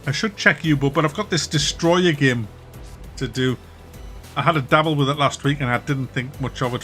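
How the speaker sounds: ordinary delivery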